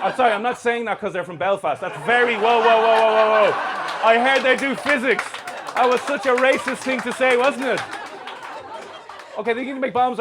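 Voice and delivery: hammed-up panicky self-correction; loud mock apology. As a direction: shout it as if caught out. Mock backpedal after offensive joke.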